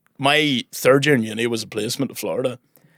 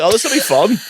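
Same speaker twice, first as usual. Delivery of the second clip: high, bright-eyed eagerness; chirpy anticipation, pitched up; mock-excited friend about to have fun; 10 semitones higher, 3 dB louder than usual. Friends excited entering cinema.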